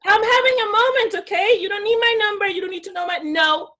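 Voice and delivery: high, loud, quick brush-off; sassy rebuff. Retold or imitated. Woman protecting her moment from a suitor, sassy rebuff.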